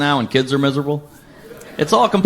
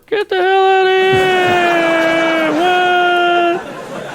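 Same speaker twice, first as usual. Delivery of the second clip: mock outrage; 13 semitones higher, much slower than usual. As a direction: yell in fake disbelief. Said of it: "mock disbelief outburst".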